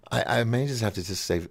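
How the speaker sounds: usual speaking voice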